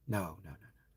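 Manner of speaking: usual speaking voice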